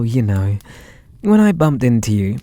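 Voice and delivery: normal talking voice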